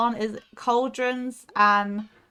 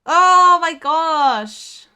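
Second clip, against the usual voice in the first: loud, very slow anguished oh my gosh; drawn-out groan; 5 semitones higher, 10 dB louder, much slower than usual. Real, not acted. Streamer wails loudly and slowly at the game's cruelty. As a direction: stretch every word in despair. After another painful fall.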